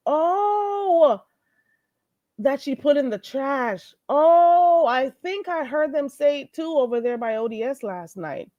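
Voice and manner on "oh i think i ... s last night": normal talking voice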